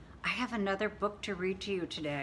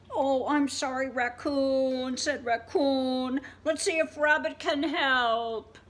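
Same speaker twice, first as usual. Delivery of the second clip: sorry raccoon; 6 semitones higher, 6 dB louder than usual. Acted. Apologetic raccoon voiced.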